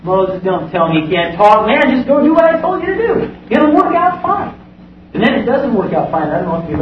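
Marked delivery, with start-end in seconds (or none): 0.0-4.5 God urging. Retold or imitated. God answers Moses.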